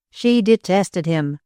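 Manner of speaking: usual speaking voice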